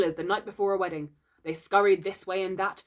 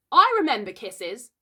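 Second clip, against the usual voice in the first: loud, higher-pitched butting in; keen, raised-voice interruption; eager interjection; 5 semitones higher, 9 dB louder than usual. A narrator's reading. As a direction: jump in eagerly. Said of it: "Slightly interposed quickly".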